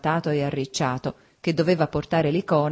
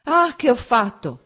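loud, higher, drawn-out outcry; remorseful cry; 7 semitones higher, 5 dB louder, slower than usual. A narrator's reading. Man realizing he made her cry, anguished shout. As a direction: cry out slowly in acted dismay. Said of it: "egli gridò".

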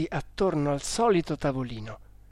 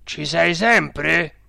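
loud, slow séance question; cook questioning; 3 semitones higher, 6 dB louder, much slower than usual. A narrator's reading. Cook checking whether the spirit remains, loud questioning. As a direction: summon an answer slowly and loudly. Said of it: "disse l'odiato manipolatore".

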